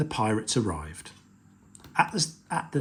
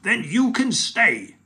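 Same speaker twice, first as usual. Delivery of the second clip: pirate chief voice; 9 semitones higher, 6 dB louder than usual. Acted. Chief granting.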